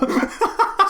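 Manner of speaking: a high squealing laugh; high cackling laugh. Real, not acted. Co-host cracking up at his own towel joke, high laugh. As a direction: let out a squeaky giggle.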